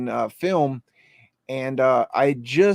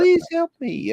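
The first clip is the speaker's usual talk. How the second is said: put-on much higher, thin little girl pleading; little girl ghost voice. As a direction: squeak it like a frightened ghost child. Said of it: "I hear this little girl say".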